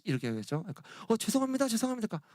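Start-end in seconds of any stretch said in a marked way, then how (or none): 1.1-2.1 high, flustered apologizing youth; young man apologizing in a rush; flustered apology. Acted. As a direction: stammer out a panicked apology.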